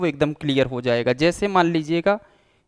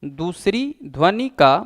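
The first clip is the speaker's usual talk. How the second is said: teacher dictating notes, one phrase at a time; dictation pace; 3 dB louder than usual. Shown as a demonstration. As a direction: read it out phrase by phrase for students to write down. Dictating the definition of Sangam.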